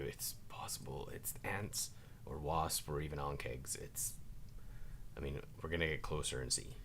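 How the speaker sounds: soft low whisper. Acted. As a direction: murmur it quietly to a friend. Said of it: "lean down and say to you".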